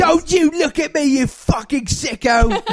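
Carol. Pretend. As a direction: scold like an outraged British harridan. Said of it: "Comes back for the holidays".

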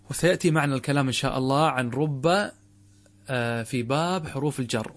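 ordinary talk, nothing marked

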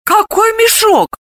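high, slow astonished question; surprised. A narrator's reading. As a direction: ask in sheer surprise. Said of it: "удивилась Далила".

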